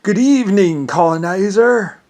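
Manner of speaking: high, loud, slow sneering greeting; mock greeting. Someone greeting him as colonizer, high and mocking. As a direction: drawl it like a sarcastic host. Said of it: "some people would call me colonizer".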